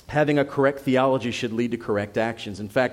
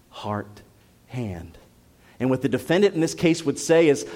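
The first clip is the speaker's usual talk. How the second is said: ordinary delivery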